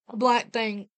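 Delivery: plain reading voice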